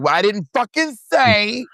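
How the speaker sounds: high mock indignation; indignant retort. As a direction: snap it back in a high put-on voice. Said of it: "Monet's like".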